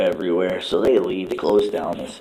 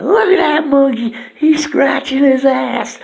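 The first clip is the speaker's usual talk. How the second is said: high, loud jeering squeak; mocking cartoon; 8 dB louder than usual. Turd mocking the monkey, high and loud. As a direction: point and jeer like a tiny cartoon. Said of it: "He's like".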